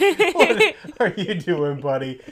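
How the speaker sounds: high, loud, drawn-out laughing question; escalated comic incredulity; exasperated disbelief. Acted. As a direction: wail it in mock bafflement. Repeats mock reaction, escalating.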